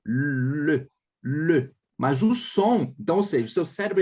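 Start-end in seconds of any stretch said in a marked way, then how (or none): 0.0-1.7 le said with pursed, rounded lips; le with a French lip pout. Shown as a demonstration. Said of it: "vai ficar assim".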